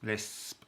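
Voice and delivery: soft Lisp with a long, hissing s; slight lisp on Lisp. Shown as a demonstration. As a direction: draw out the lisped s in Lisp. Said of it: "I've got a little bit of a lisp".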